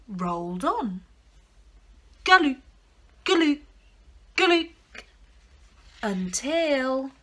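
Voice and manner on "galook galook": usual speaking voice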